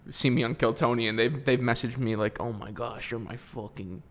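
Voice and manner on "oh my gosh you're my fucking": soft, low mock Middle Eastern accent; put-on Middle Eastern accent. Comic quoting fans' messages, mock Middle Eastern accent. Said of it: "why I do that accent".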